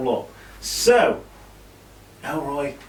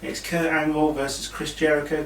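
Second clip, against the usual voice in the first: ordinary delivery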